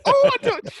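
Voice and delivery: very high, loud despairing squeak; exasperated squeal. Retold or imitated. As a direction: throw up your hands in a squeal. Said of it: "and I'm like".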